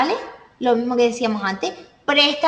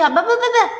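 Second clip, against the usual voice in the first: put-on high, louder baby gaga; baby babble; 9 semitones higher, 4 dB louder than usual. Teacher imitating one twin babbling to the other, high baby voice. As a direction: babble like a twin talking to his brother. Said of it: "y el otro dice".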